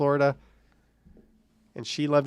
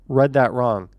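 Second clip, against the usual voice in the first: ordinary talk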